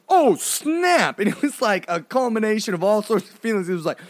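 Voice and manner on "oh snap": high, loud "whoa" reaction; retold shocked exclamation. Brother sees her hit the pole, mock shocked outburst. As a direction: burst out in comic shock. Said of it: "and I was like".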